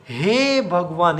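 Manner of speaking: हे drawn out long and slow; stretched exclamation. Shown as a demonstration. Teacher showing how people sigh it, हे stretched. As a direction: drag the हे in हे भगवान. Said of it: "हे को खींचते हैं".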